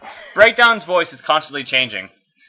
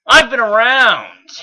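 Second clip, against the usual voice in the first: put-on cocky young bot; 9 semitones higher, 8 dB louder, slower than usual. B answering Megatron coolly.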